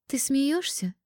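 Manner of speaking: high-pitched, incredulous question; indignant. A narrator's reading. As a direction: ask it in rising indignation. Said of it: "возмутилась я".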